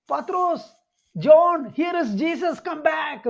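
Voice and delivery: high-pitched mock call to friends far off. Mary calls back Peter and John as they walk away, calling out. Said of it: "അവരെ തിരിച്ചു വിളിക്കും".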